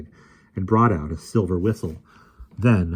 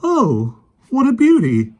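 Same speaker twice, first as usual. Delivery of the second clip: high, loud delighted cry; delighted exclamation; 10 dB louder, slower than usual. A narrator's reading. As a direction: gasp with delight at the lion. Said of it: "he exclaimed".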